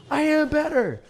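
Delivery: very high, loud "I am better"; shouted boast. Real, not acted. Streamer yells his boast at chat, high and loud. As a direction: yell the boast as the peak.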